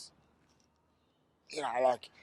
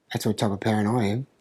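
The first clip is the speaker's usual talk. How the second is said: ordinary talk, nothing marked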